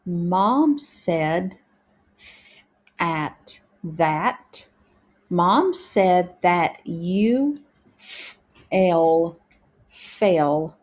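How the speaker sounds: slow beginner reading, breaking words into sounds; practicing together. Shown as a demonstration. Teacher practicing sentences together with pupils, slow sounded-out reading. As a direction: read word by word, blending "fell" and "pond". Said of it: "let's practice together now".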